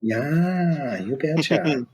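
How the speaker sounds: high, drawn-out Midwestern "yeah"; Midwestern accent. Pretend. Trading catchphrases with friends, playful high Midwestern accent. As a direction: put on a playful Midwestern lilt, pitched high.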